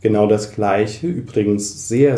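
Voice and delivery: ordinary talk, nothing marked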